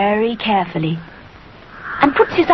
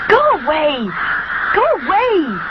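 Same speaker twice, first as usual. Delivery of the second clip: crying out; 8 semitones higher than usual. A narrator's reading. Rupert shooing away the crows, calling out. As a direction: shoo the crows off. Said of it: "cries Rupert".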